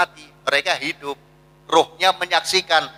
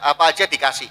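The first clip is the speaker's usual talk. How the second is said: ordinary talk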